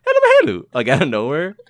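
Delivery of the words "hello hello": much higher and louder sing-song hello; random squeaky hello. Retold or imitated. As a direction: blurt a sudden shrill greeting out of nowhere. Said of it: "she'll just be on her phone and just like".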